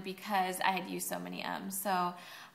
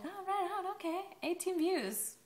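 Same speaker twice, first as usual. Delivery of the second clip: high, bright "oh, right on"; pitched-up happy surprise; pleased surprise; 8 semitones higher, 4 dB quieter than usual. Retold or imitated. Pleased surprise at view count.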